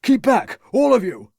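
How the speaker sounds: frantic youth yelling keep back; shouted. A narrator's reading. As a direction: scream them away from you. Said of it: "shouted Rupert".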